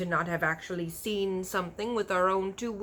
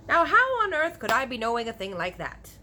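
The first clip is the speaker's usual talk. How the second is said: exasperated giant; 4 semitones higher, 6 dB louder than usual. A narrator's reading. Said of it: "said the B F G".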